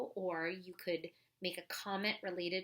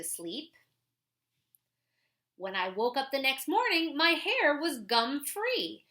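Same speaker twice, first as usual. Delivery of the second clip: put-on clear, lively read-aloud voice for kids; reading a picture book aloud; 3 semitones higher, 4 dB louder than usual. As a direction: read it like a story for the class. Reads a children's book aloud.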